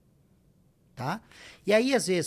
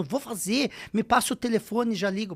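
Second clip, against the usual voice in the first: eager; 6 semitones higher, 3 dB louder than usual. Retold or imitated. Quoting eager businessmen.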